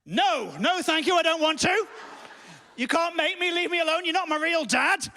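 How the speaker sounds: put-on high, loud childish tantrum; panicked refusal. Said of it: "I was panicking".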